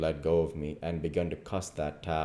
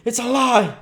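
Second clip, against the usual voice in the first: very high, loud outburst; furious denial; 12 dB louder than usual. Acted. Duke about to go for the king, furious shout. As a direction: shout down the accusation.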